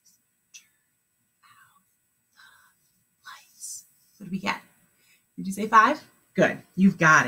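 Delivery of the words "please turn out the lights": whispered, much softer. Shown as a demonstration. Teacher showing how to repeat silently, whispered. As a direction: whisper it as if in your head. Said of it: "say it back in your head like this".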